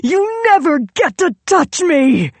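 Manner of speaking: high, loud howl of rage. A narrator's reading. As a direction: howl it at him, furious. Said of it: "she howled".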